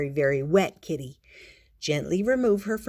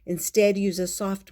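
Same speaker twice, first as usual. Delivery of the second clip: ordinary delivery, nothing marked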